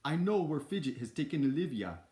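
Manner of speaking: put-on botched British accent gone full Schwarzenegger. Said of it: "that turned into Schwarzenegger real quick".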